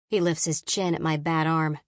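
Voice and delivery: usual speaking voice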